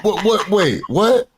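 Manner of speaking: high, stunned string of questions; mock disbelief. Retells woman's shocked reaction.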